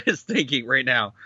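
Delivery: normal talking voice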